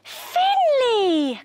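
high, drawn-out joyful call; Lily's high, happy exclamation; delighted cry. A narrator's reading. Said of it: "cried Lily".

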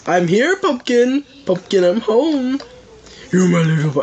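high sweet doting voice. Pretend. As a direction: coo at the little pumpkin. Doting baby talk to pumpkin.

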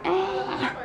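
high single mock "ah" cry; a quick high baby "ah"; imitated baby wail. Showing how the baby used to cry, high. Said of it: "instead of just like".

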